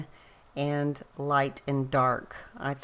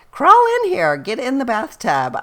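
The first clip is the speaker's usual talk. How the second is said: high, loud call into the bathtub; 7 semitones higher, 8 dB louder than usual. Retold or imitated. Mom calling her daughter into the bathtub, high loud call. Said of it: "And I said".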